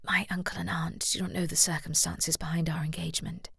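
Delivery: soft, breathy whisper; whispering to her fiancé so the table won't hear. A narrator's reading. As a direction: lean in and whisper a secret. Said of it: "Deborah whispered to Trenton".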